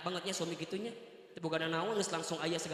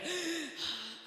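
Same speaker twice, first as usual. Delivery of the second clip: gasp; 8 semitones higher than usual. Retold or imitated. Woman's face transforms.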